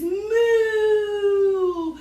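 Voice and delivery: quick cartoon moo for kids; cow moo.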